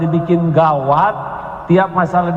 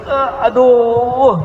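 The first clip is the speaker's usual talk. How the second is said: high, slow drawn-out aduh; mock groan; 7 semitones higher, much slower than usual. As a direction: ham up a sore-back moan on waking. Fussy person waking with backache.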